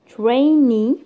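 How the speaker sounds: "trainee" drawn out, loud and swelling on NEE; stress on last syllable. Shown as a demonstration. Teacher demonstrating suffix stress, slow stress on the end of "trainee". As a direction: stretch "trainee" slowly, leaning on the final syllable. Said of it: "stress جاء عند last syllable".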